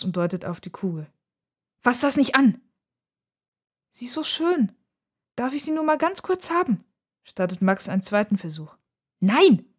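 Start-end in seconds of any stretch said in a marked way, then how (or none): 1.8-2.6 high, urgent reprimand; sharp warning. A narrator's reading. Sister stops brother touching the globe, sharp warning. 3.9-6.8 high-pitched little-brother begging; pleading. A narrator's reading. Brother asks to hold the globe, pleading. 9.2-9.7 loud, high outburst; louder refusal. A narrator's reading. Nora refuses her brother's second try, louder. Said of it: "sagt Nora noch lauter".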